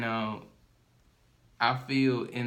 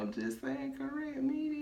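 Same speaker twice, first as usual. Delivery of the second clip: very high, softer put-on voice; squeaky protest; 5 dB quieter than usual. Co-host backing down after being challenged, squeaky mock protest. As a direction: plead in a tiny high pitch.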